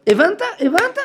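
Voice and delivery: high, loud, pressing "הבנת?" twice; mock teacher demanding understanding; emphatic repeated question. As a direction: press the student: got it? got it?. Quoting Maharal's final question.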